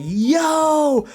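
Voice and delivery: long, high, loud yell; shouted amazement. Acted. Streamer reacting to the story, amazed yell. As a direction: yell it out in hyped disbelief.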